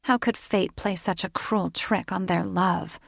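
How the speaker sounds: ordinary delivery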